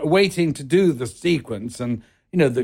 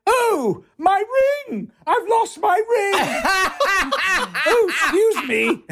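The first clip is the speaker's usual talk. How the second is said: much higher, hammed-up squeal over a lost ring, laughing; mock panic. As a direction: shriek in fake horror, giggling. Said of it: "and went".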